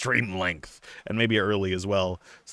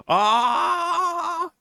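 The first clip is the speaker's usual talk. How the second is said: high excited squeal. Real, not acted.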